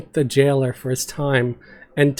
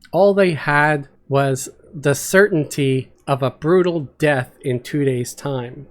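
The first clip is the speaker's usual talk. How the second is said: normal talking voice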